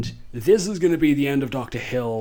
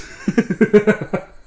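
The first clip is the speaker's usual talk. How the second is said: short high-pitched laugh, louder; real amused laugh; 8 semitones higher, 6 dB louder than usual. Real, not acted. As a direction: giggle out loud. Laughing at Herbert's disguise.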